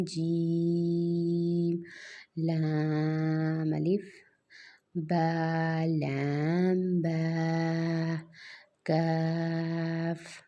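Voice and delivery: each letter name drawn out very slowly; slow letter drill. Shown as a demonstration. Teacher drilling children in slow letter recitation.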